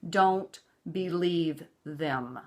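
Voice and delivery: very slow, deliberate warning; slow earnest warning; firm warning. Real, not acted. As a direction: lay each word down slowly. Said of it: "slow emphatic warning".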